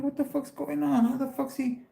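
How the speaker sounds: high-pitched who's-on-probation outrage; outraged disbelief. Retold or imitated. Hearing the judge mention probation, outraged. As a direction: replay the courtroom shock, pitch way up. Retelling being hauled out of court.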